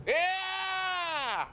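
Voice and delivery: high, drawn-out war whoop; battle cry. Acted. Player voicing Danny charging into the fight, battle cry. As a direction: let out a long, high battle yell. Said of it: "And with a battle cry".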